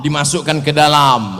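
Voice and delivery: ordinary delivery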